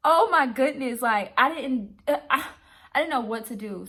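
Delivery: loud, high, flustered exclamation; loud disbelieving rant; exasperated outburst. Real, not acted. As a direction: throw up your hands out loud. Overwhelmed by host's reversal.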